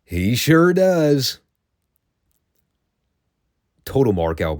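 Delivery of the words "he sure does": drawn out high with glee; slow, high-pitched gleeful imitation; gleeful David. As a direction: relish every word, stretched and gloating. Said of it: "the glee in David's voice when he goes".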